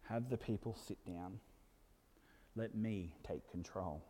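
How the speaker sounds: soft, low and unhurried; a calm, hushed command, as if faintly from afar; small, still voice. Acted. The Saviour quietly telling the disciples to take over, small still voice. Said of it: "faintly off in the distance that small, still voice".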